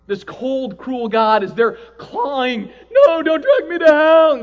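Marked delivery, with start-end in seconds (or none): 2.9-4.3 high, loud frantic shout; loud, high mock terror; desperate plea. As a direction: scream as you are dragged away. Soul clawing, begging God.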